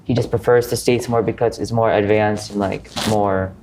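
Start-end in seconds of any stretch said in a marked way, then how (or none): none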